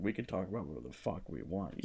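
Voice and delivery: very soft, low and quick; hushed mock-secretive. Podcast host opening the spoiler segment, hushed. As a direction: keep it down like a naughty secret.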